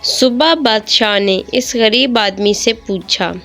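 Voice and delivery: ordinary delivery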